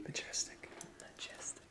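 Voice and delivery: breathy awed whisper. Pretend. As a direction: whisper it in mock wonder. Mock awe at unicorn.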